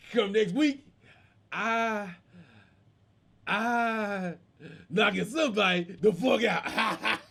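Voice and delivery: mock stammer with long stalls; imitated halting stutterer; can't speak well. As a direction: stall on one word, then drag out the rest. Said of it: "This dude can't speak well".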